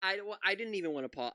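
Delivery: ordinary talk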